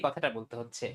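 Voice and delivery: ordinary talk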